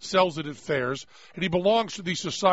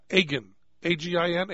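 ordinary delivery

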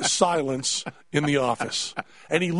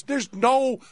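high, exasperated retold scolding; emphatic, pitched up, brushing the patient off; fed-up dismissal; 5 semitones higher, slower than usual. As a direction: imitate a doctor who is completely done.

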